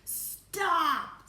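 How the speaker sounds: high, loud screamed command. A narrator's reading. Ground Control cutting off the astronaut, screaming. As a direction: scream it to cut him off. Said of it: "screamed Ground Control".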